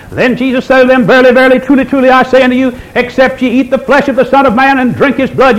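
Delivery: high, sustained preacher's declamation; scripture reading. Acted. Preacher reading John six to listeners, declamatory scripture reading.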